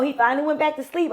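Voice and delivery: ordinary talk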